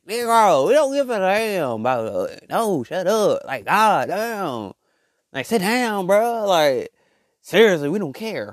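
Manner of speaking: high-pitched, fed-up outburst; exasperated telling-off. Acted. Telling off the loud talker at party.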